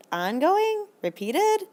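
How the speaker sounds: high, puzzled questioning voice; confused student. Retold or imitated. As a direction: play a baffled student asking back. Teacher mimics confused student.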